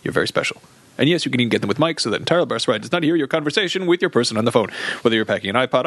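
mock-earnest sponsor read. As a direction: read it like a cheesy ad. Reads ad copy.

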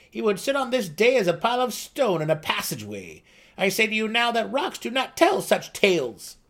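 high, loud lecturing gnome; Seldig the gnome. A narrator's reading. Seldig lectures the boasting gnome, high and loud. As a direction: lay it out like a know-it-all elder.

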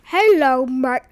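loud, off-target hillbilly accent; attempted hillbilly accent. Shown as a demonstration. Kid's first try on request, botched hillbilly accent. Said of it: "do your best redneck hillbilly accent".

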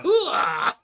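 loud, high Pacino hooah bark; gung-ho soldier shout. Retold or imitated. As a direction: yell it like a pumped-up army colonel. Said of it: "He was like".

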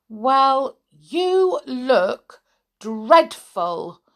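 drawn-out tut-tut; a hint of a stern teddy; Teddy's scolding.

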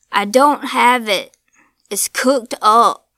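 put-on old man shouting through the door; yelling. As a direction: yell back at the thing outside. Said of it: "The old man yelled".

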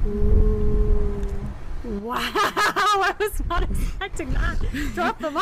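impressed, laughing. Real, not acted. Host reacting to an answer she was not expecting, laughing and impressed.

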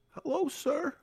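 high, soft put-on wrestler greeting; a hint of the in-ring character. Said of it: "he's like".

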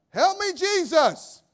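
loud, high, slow cry for help; desperate cry. Pretend. Driver crying out to Jesus halfway to work, loud and pleading. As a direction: howl it out like you're stuck in traffic. Mock desperate prayer in traffic.

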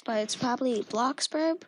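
ordinary delivery

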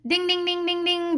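high, level ding-ding sound; alert bell imitation. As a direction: chime it like an alarm bell.

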